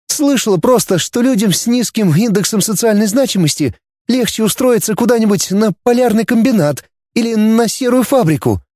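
put-on girl Dana explaining. A narrator's reading. (девушка объясняет, выше, притихшая)

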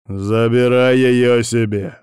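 loud, slow, insistent order; cold pressure. A narrator's reading. Лев pushing him to claim her, cold insistence. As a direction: lean coldly on every word. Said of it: "холодно надавил Лев".

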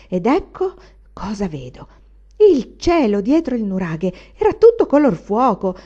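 an old servant's ghost story rising into mock fear; old woman's scary tale. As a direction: tell the vision of the giant as if living it. Varvara's eerie childhood vision.

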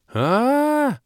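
high, loud drawn-out scream; piercing girlish squeal; piercing shriek. A narrator's reading. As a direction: shriek out in shock. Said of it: "пронзительно завопила Кира".

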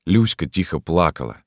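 usual speaking voice

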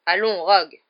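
loud, mildly startled reproof; taken aback by a colleague; surprised. A narrator's reading. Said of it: "dit Fudge surpris".

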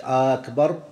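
"أكبر" opened with a long drawn-out "aa"; lengthened hamza. Shown as a demonstration. Teacher recapping the forbidden lengthening, "أكبر" begun with long "آ". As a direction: stretch the first vowel of "أكبر" into "آ". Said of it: "ألا يمد الهمزة".

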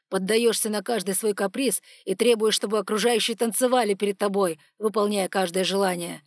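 loud, hard angry rant; furious tirade. Acted. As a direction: let the rage out on her. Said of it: "dragon's furious tirade".

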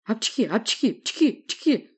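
rapid burst of acted sneezes; high, staccato sneezing fit; machine-gun sneezes. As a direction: sneeze over and over in a quick volley. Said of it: "выдал пулемётную очередь".

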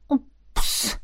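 breathy unvoiced whisper of surprise; whispered oops. Girl at the laptop whispering in confusion. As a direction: breathe the word out under your breath. Reads a strange file at night.

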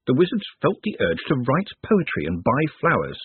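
plain reading voice

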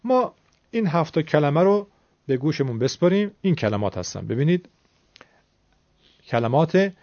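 ordinary talk

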